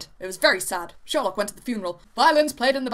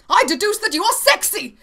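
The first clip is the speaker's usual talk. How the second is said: high, loud proclamation; dramatic declaration; 9 semitones higher, 5 dB louder than usual. A narrator's reading. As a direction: announce the deduction grandly, pitched way up. Sherlock's mock-dramatic deduction.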